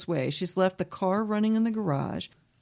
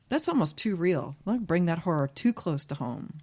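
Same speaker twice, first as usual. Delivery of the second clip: ordinary talk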